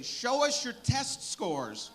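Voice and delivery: high, slow, drawn-out mock yell; imitated crowd yell. People in Mobile calling for beads, mock yelling. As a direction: call it out slowly and high, without full volume. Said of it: "used to yell out".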